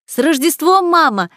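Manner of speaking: high, loud festive greeting; joyful greeting. A narrator's reading. Daughters wishing their mother merry Christmas, calling out joyfully. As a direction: call it out brightly to the whole house.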